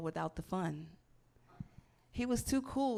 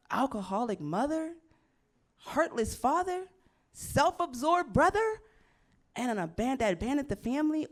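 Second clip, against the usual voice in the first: building rant; 6 semitones higher, 6 dB louder than usual. Real, not acted. Listing her broken family, building to a loud rant. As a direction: climb into an exasperated yell.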